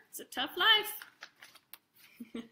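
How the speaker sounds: slow, high, hammed-up lament; mock drama. Joking to the kids about business, slow mock-weary lament. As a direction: sigh it out like a weary tycoon.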